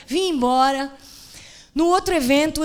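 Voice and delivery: ordinary talk, nothing marked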